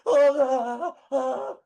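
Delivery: high loud screaming; mock scream. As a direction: scream like the scared kid. Terrified rider screaming.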